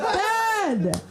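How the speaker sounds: high, mock-scandalized outburst; appalled cry. Comedian to dad after his greeting, appalled cry. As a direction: cut Dad off in high-pitched dismay. Embarrassed child scolding dad, acted.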